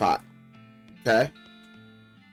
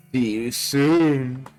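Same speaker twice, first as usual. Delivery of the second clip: creepy doll; much slower than usual. Acted. Doll's parting line.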